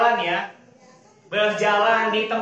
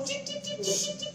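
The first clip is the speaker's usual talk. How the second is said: very high, soft chirping cip; bird chirps; 10 dB quieter than usual. Shown as a demonstration. Leader teaching the bird answer, high soft chirps. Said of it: "mana suara burung".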